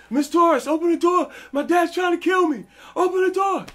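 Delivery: high, loud desperate pleading; panicked yelling. Retold or imitated. Boy begging his friend's mom to open the door, panicked yell. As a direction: bang on the door and beg for help. Begging neighbour while fleeing dad.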